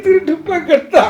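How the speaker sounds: high-pitched sobbing wail; crying. Acted. Uncle to niece over being called a thief, crying. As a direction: wail it through sobs. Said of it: "அழுவாதீங்க சித்தப்பா".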